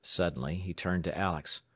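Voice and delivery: ordinary delivery, nothing marked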